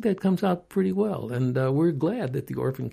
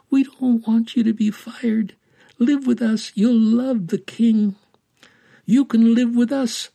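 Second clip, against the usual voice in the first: put-on higher, insistent child's entreaty; pleading; 6 semitones higher, 5 dB louder than usual. A narrator's reading. Blind girl urges the young woman to live with them, pleading. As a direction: plead eagerly like a little girl.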